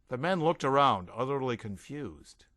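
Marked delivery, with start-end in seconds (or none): none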